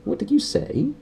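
high sharp snap; snapping aunt. Acted. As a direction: snap the question suspiciously. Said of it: "his aunt snapped".

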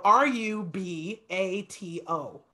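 slow letter by letter R U B A T O; spelled out. Shown as a demonstration. Teacher spelling the word letter by letter for student. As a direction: spell each letter slowly and evenly. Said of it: "So I'll spell it".

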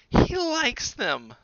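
high, loud, slow besotted mother; high slow gushing mother voice; doting Mama. A narrator's reading. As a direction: gush over him slowly. Mama admires the wolf.

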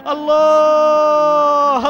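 drawn-out shout. Real, not acted. Commentator hails the winning camel at the finish, a stretched ecstatic shout. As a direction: hold the word in one huge ecstatic call. Race commentator cheering the winner.

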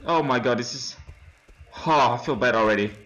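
usual speaking voice

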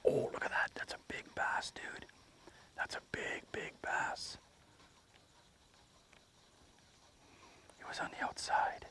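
soft breathy whisper fading almost to nothing; awed whisper. Real, not acted. Angler whispering in awe at a big bass, hushed. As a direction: hush it, marvelling at the fish.

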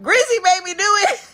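high whining accusation; whiny friend. Retold or imitated. Friend blaming Grizzy to the guards, high whine. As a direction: whine it like a caught kid. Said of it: "And she's like".

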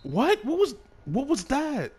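high loud disbelieving shriek; shocked yell. Real, not acted. Shocked at game animation.

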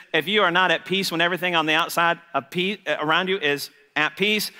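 ordinary delivery

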